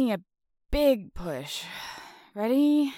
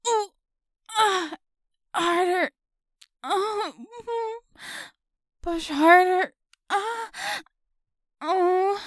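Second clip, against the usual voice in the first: high, loud urgent effort cries; straining; 8 semitones higher, 4 dB louder than usual. Acted. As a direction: strain and cry out as it moves. Hard push to free her.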